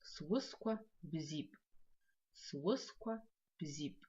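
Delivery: each syllable drawn out slowly; careful slow teacher demo; slow model word. Shown as a demonstration. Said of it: "Подумайте".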